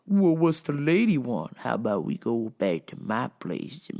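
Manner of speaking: low, sleazy come-on; creepy guy voice. Retold or imitated. Creepy guy trying to take the drunk friend home, in a sleazy voice. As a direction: lean in like a creep. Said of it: "And he's like".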